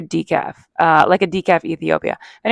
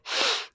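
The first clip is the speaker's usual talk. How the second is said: sniff; 12 dB quieter than usual. Retold or imitated. Smelling candles in the store.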